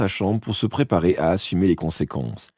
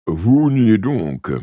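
grave voice; 4 semitones higher, 5 dB louder, much slower than usual. A narrator's reading. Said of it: "dit-il d'une voix grave".

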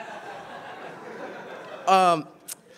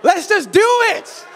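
higher, louder and slow insistence; eager demand; 9 semitones higher, 4 dB louder, slower than usual. Retold or imitated. Boy volunteering for the remedy, loud insistent demand. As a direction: insist you're ready, stretched out. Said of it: "I was like".

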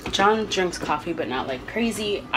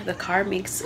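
ordinary talk

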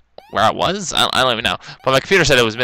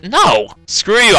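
high, raised retort; indignant defiance; 9 semitones higher, 4 dB louder, much slower than usual. Real, not acted. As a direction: snap back at the game. Defies the game's give-up prompt.

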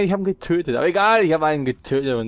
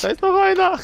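panicked; 4 dB louder than usual. Real, not acted. Second enemy, still panicking.

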